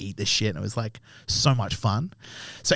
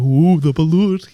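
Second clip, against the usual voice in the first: slow, raised, playful ooh of dread; mock spooked; 5 semitones higher, 6 dB louder, slower than usual. Friends refusing to touch the balut, mock spooked. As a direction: pretend to be scared, louder and higher. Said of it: "It's too scary".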